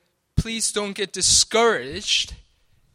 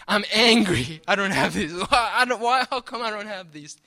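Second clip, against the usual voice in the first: mock angry prayer; 4 dB louder than usual. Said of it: "Your sinfulness coming out".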